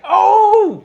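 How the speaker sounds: high, loud gasp of surprise; a big startled "Oh!"; mock surprise. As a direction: camera pans to Liv, loud and high surprise. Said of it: "and you're like".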